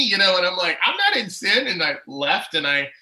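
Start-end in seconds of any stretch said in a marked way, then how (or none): 0.8-1.7 loud, high, retold protest; defensive denial. Denying the accusation to a friend, indignant. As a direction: deny it hotly, reenacting the argument.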